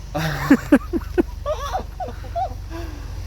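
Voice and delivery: a burst of high, stunned laughter; surprised high laugh. Real, not acted. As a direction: laugh out of pure disbelief.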